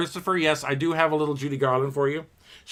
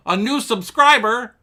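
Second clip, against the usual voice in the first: high, loud delighted squeal; thrilled to greet a new subscriber, squealing high; excited; 12 semitones higher, 8 dB louder than usual. Pretend. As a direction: gush over the new subscriber. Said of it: "I'm gonna go".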